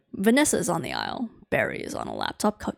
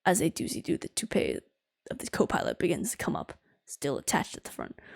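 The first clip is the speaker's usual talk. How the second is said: usual speaking voice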